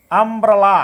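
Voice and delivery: আমব্রেলা loud, with open "ah" at the start; teacher dictating আমব্রেলা clearly and firmly; opening "ah" sound. Shown as a demonstration. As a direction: sound out আমব্রেলা in drawn syllables. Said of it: "এই ইউ এর উচ্চারণটা কি?".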